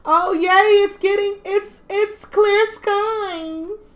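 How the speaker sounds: high, loud and drawn-out joy; delighted squeal. Real, not acted. Delighted at in-game weather.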